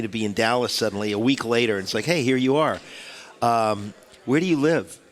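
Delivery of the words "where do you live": usual speaking voice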